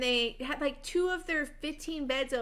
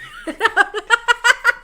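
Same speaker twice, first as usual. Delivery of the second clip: a real burst of loud laughter, much higher and louder; 10 semitones higher, 15 dB louder than usual. Real, not acted. Friend cracks up loudly at the old rapper joke, real laughing burst.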